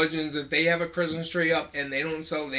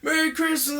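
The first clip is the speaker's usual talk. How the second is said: cheery greeting; 8 semitones higher than usual. Retold or imitated. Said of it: "people were like".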